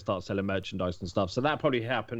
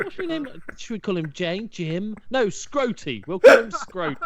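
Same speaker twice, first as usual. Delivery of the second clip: high, sing-song parent mocking the name; silly parent voice; 9 semitones higher, 7 dB louder than usual. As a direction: play the proud parent naming the baby. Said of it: "what parents are gonna sit there and go".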